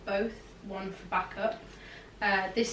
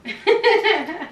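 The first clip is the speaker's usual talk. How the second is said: high bright burst of giggling; loud giggle; 10 semitones higher, 10 dB louder than usual. Real, not acted. Laughs after interrupting.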